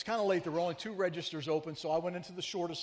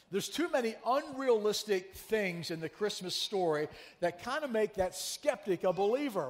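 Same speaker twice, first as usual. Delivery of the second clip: ordinary talk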